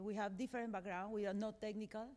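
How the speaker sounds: normal talking voice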